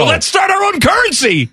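high excited shout. Real, not acted. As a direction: burst out with the idea. Excited shout of new idea.

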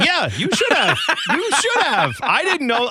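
high, laughing admission. Real, not acted. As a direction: crack up while conceding. Laughing concession.

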